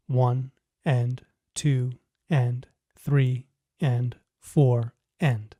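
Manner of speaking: slow, even counting of the beats; counting aloud. Shown as a demonstration. Teacher counting beats slowly for students. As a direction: count it out steadily, one beat at a time. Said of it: "the count is the same as before".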